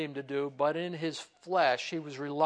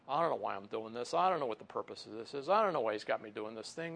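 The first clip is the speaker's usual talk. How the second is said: fast, under-the-breath grumble; muttering complaint; 6 semitones lower, faster than usual. Pretend. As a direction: rattle off the gripes to yourself. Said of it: "Under your breath saying".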